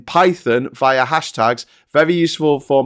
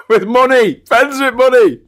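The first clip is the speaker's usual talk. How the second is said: high, loud jokey squawk; loud high-pitched comic outburst; gleeful interjection; 9 semitones higher, 6 dB louder, slower than usual. Pretend. Jokey interjection after laughing.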